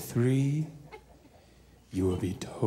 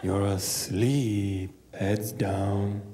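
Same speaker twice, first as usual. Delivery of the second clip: slow, drawn-out trance voice; slow hypnotic voice; much slower than usual. Acted. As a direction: lull them to sleep. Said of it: "slow hypnotic drawl".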